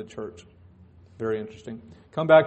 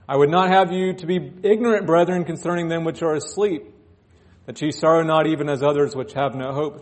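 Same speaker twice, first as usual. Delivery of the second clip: raised, ringing scripture proclamation; scripture reading; 3 semitones higher, 5 dB louder than usual. Acted. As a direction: proclaim the verses to the church. Reads scripture aloud.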